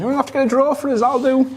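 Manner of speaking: playful high voice. Real, not acted. Joking along, high voiced.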